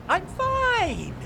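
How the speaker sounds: I'm fine! jumped way up in a strained, overbright pitch; a cracking fake-happy squeal; forced cheer. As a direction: insist you're fine far too high and bright. Owner forced cheerful insistence.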